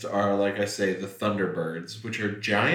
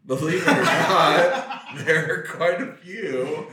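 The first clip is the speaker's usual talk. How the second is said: louder, laughing through it; 7 semitones higher, 7 dB louder than usual. Real, not acted. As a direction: chuckle while talking. Laughing answer.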